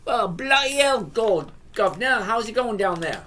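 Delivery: hammed-up Aussie greeting that slips into British; bad Australian accent. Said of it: "that was a bad accent".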